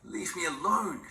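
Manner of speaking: put-on sharp, high hiss of a frightened old woman; a panicked grandmother hissing at her grandchild. A narrator's reading. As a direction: spit it out to drive them away. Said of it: "she hissed".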